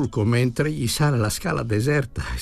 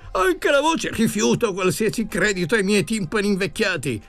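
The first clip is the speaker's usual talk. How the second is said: high-pitched, frantic denial; desperate, insistent outburst of refusal; cried out; 7 semitones higher than usual. A narrator's reading. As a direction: declaim vehemently, voice pitched high. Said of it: "aveva gridato parole così virili e incoraggianti".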